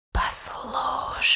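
slow unvoiced demonic hiss; demonic whisper. A narrator's reading. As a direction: hiss it like an ancient evil. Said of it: "прошипела тьма".

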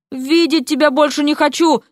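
hurt, heated exclamation; flung retort. A narrator's reading. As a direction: throw it at him as you leave. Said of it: "бросила она".

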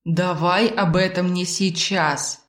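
slow, measured plea to drop it; calm, lingering appeal; gentle request. A narrator's reading. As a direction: ask unhurriedly to put it off. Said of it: "попросил бесплотный".

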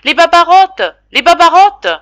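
very high, very loud horrified shriek; cry of distress. A narrator's reading. Old maid in the kitchen, shrieking in distress. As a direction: scream at the sight of the cockroaches. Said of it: "poussa un cri de détresse".

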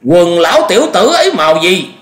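high, loud, eager demand; urgent quick question. A narrator's reading. Old master testing the eyewitness, urgent. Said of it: "Khuất Tịch hỏi nhanh".

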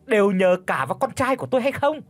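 high, fast gloating scold; triumphant insults. Acted. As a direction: crow over her while insulting. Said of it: "đắc thắng thì xỉ vả". (mẹ chồng đắc thắng xỉ vả, giọng cao)

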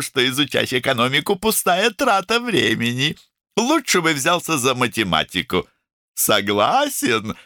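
high, emphatic, opinionated character voice; outspoken woman doctor. A narrator's reading. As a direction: hand down brisk opinions in a high voice.

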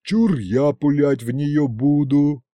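slow, drawn-out teasing; lightly voiced cheeky sidekick; teasing henchman. A narrator's reading. Henchman bagging the shot at Masha, teasing.

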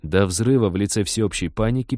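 ordinary delivery

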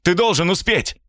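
high, urgent call back; urgent shout. Acted. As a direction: shout it over the shoulder. Stranger shouts while leaving.

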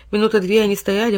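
ordinary delivery, nothing marked

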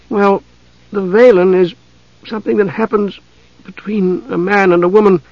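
put-on high, halting girl's explanation; embarrassed. A narrator's reading. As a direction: falter shyly between phrases. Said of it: "her cheeks flushing crimson".

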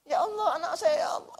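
a high, despairing cry to God; anguished wail. Acted. Parent in the afterlife wailing for their child, anguished. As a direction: cry out for your lost child.

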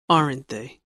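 "aren't they?" falls low at the end; falling tune on "aren't they?"; falling tag question. Shown as a demonstration. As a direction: let "aren't they?" sink as a sure statement. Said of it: "falling intonation or rising intonation".

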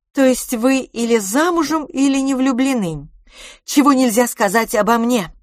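high, forceful questioning; brash Miss Dubarry. A narrator's reading. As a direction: challenge her in a high, loud voice. Miss Dubarry speaks in story. (напористо, высоким голосом)

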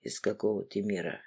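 low, quiet menacing man; stern man's voice. Acted. Martin interrogating, voiced low.

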